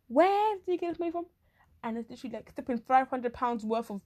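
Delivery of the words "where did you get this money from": high, incredulous question; astonished classmate, pitched high; mock disbelief. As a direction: act baffled, voice jumping up. Acting baffled reaction to classmate.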